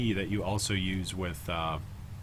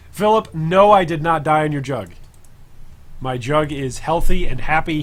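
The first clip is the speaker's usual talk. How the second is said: raised, emphatic denial; loud protest; 4 semitones higher, 9 dB louder than usual. Real, not acted. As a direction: protest loudly, half laughing. Denying chat accusation emphatically.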